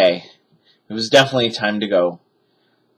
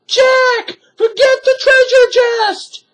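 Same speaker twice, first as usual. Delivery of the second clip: very high, loud distant call; shouting; 5 dB louder, slower than usual. A narrator's reading. Sister shouts from treehouse window, loud call. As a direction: shout down from above. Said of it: "said Annie".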